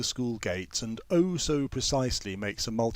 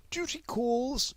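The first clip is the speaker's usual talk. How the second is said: high, slow sing-song trill; trilling Miss Lomax; 14 semitones higher, slower than usual. A narrator's reading. Miss Lomax leaving the sick room, high trill. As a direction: trill it out brightly in a high lilt. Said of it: "she trills".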